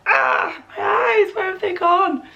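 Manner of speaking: high hammed-up wail about her eyes; mock anguished cry. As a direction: cry out in fake dismay, pitched high. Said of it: "you're like".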